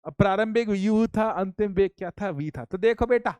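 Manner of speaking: ordinary talk, nothing marked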